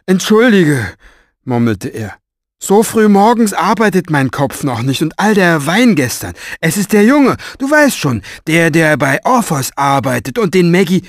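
high, loud, blustering apology; flustered strong man. A narrator's reading. Strong man apologizing to Mo, high and loud. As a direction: babble it out, embarrassed and hungover.

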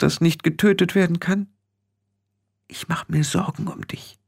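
high, hushed pleading; tearful. A narrator's reading. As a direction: confess worry in a fragile high voice. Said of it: "sagte Ginny und begann zu weinen".

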